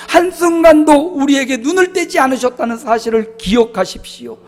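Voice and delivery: a pastor exhorting his flock with fervor; preaching at full force. Acted. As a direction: urge them to remember grace.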